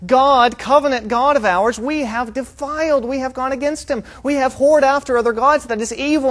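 high, loud, hammed-up dismay; mock crying out. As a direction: imitate people appalled at their own guilt. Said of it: "They're not crying out because they're going".

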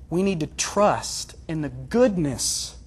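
slow, deliberate weight on "goodness"; stress on goodness. Real, not acted. Preacher making his third application, stressing "goodness". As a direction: draw the sentence out towards a stressed "goodness". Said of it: "and I stress that word".

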